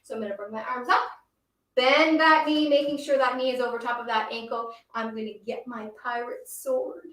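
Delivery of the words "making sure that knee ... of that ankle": ordinary talk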